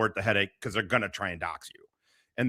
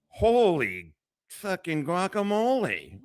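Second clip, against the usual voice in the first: slow, higher drawn-out exclamation; stunned disbelief; 6 semitones higher, much slower than usual. Real, not acted. Reacting to something shocking on stream, slow stunned exclamation. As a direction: let the shock stretch every word.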